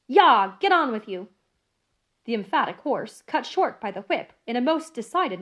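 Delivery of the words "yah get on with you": loud urging. Acted. Driving the horses on.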